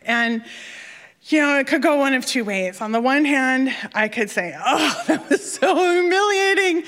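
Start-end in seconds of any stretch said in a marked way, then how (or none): 4.6-6.8 high-pitched wail of embarrassment, half laughing; mock lament. Diver alone after her flop, mock wailing. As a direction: bemoan it with hammed-up shame.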